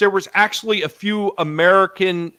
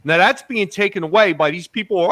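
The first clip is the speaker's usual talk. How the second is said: normal talking voice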